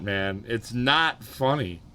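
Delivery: ordinary delivery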